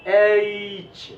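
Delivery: एच said as British aitch, clear and full; careful demo of aitch; English h letter name. Shown as a demonstration. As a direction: say एच carefully, not Hindi ech. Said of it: "ऐसे बोलना है".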